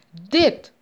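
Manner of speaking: single loud, furious word; acted angry yell. A narrator's reading. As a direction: burst out with it as a threat. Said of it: "gilde mevrouw Anansi".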